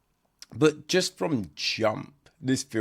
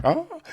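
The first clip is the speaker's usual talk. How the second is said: gasp of pain; 5 dB louder than usual. Retold or imitated. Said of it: "She's like".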